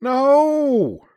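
very high, loud anguished wail; high-pitched distraught cry. A narrator's reading. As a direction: wail it out in distress, long and piercing. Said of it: "Lucchini wailed".

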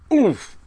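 loud, high, sudden "oof"; perplexed oof. A narrator's reading. Girl imitating professor finding his mistake, perplexed oof. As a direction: exclaim it high and short, as if caught out. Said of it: "with this perplexed look on his face".

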